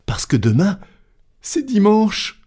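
high, agitated explanation, climbing at the end; frantic servant. Acted. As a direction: panicky valet voice.

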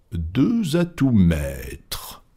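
very slow, deliberate delivery; unruffled announcement. Acted. Fogg lays down his hand, slow and unruffled. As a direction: set down each word at leisure.

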